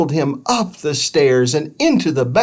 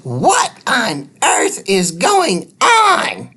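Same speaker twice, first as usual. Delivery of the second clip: a cross neighbour demanding answers; booming woman; 10 semitones higher, slower than usual. Acted. Missus Scuttle to the dog, a booming scold. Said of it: "boomed a woman's voice".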